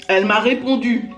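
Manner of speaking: ordinary talk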